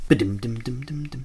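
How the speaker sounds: soft sung ba-dum drum sound; mock drum fill. Host closing a legal disclaimer with a drum sting. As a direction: hum a little drum sting.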